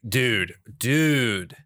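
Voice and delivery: ordinary talk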